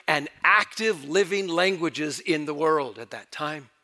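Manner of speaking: ordinary talk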